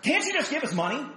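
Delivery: hammed-up demand for cash; exasperated couple. Pastor mimicking the couple's exasperated plea. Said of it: "I hear this phrase".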